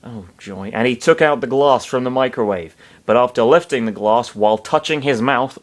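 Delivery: ordinary delivery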